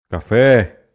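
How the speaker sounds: "Café" called out loud, final syllable FÉ held long; stretched calling-out. Shown as a demonstration. Teacher shows class how to find the stressed syllable, stretched call. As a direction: call "Café" as if shouting for someone, dragging the FÉ. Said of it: "como se a gente estivesse gritando alguém".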